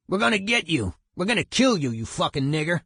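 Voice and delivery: mock menacing yell, pitched high; retold threatening yell. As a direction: throw a threat from a truck, half-acted. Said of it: "started yelling".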